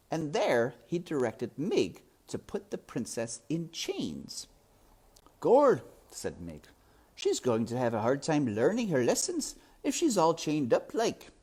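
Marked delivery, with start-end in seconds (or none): 5.4-11.3 put-on higher, louder country girl; simple girl chatting; serving girl. A narrator's reading. Mig talks to Roscuro, higher and louder.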